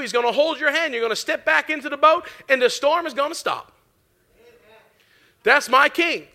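rising, emphatic sermon voice; fervent preaching. Acted. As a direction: preach it with full conviction. Preaches at full force.

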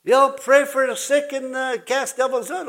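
high-pitched voice of a caller; imitated church caller. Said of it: "some people from the Methodist church called and said".

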